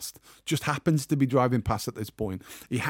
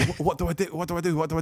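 panicked thoughts; 5 semitones higher, much faster than usual. Retold or imitated. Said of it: "in my mind, I'm thinking".

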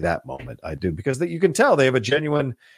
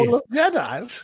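high, mock-grand king voice; pompous king voice; 12 semitones higher than usual. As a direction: play a haughty king. Imitating Longshanks' line.

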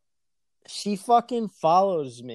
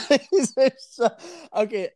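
words breaking into laughter; slow, helpless laughing start; 6 semitones higher than usual. Real, not acted. Laughing over own bad messages.

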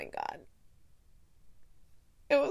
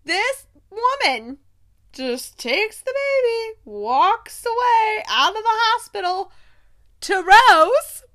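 higher and louder, slow then climbing to a loud finish; building outrage; 8 semitones higher, 6 dB louder than usual. Real, not acted. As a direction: deliver the cliffhanger with growing shock. Cliffhanger reveal, rising outrage.

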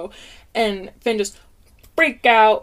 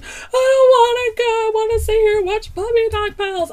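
put-on much higher, whining little-kid voice; whiny toddler; 14 semitones higher, 7 dB louder than usual. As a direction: play the son begging to stay. Imitating son's tantrum.

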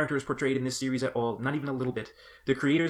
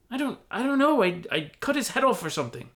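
high, stammering flustered voice; 12 semitones higher than usual. Acted. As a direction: stumble over the words. Flustered hobbit.

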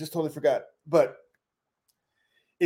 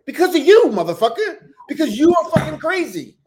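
1 mock rage; 10 semitones higher, 7 dB louder than usual. Mock angry woman outburst.

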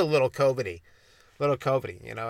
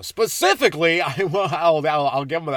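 "Specifically" rolled out in a louder radio host read; 4 semitones higher, 5 dB louder than usual. Shown as a demonstration. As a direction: announce "Specifically" like a DJ. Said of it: "I won't speak like a radio host".

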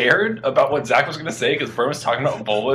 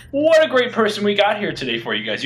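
hype host intro; 6 semitones higher, faster than usual. Acted. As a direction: announce the guest like a showman. Hyping the guest intro.

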